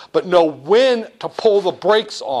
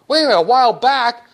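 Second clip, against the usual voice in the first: quick, higher surprised protest; mock surprise; 7 semitones higher, 4 dB louder, faster than usual. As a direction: blurt it out, caught off guard. Banker role protests.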